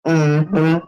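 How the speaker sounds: ordinary talk, nothing marked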